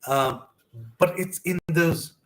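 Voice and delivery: halting, pausing to collect yourself; moved by the memory, with long breaks; getting emotional. Real, not acted. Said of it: "this is so emotional when I remember".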